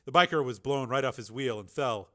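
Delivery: usual speaking voice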